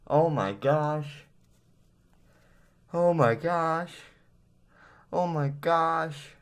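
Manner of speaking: slow, drawn-out scared oh my gosh; frightened, high and stretched out; scared gasps. Real, not acted. Reacting to scare.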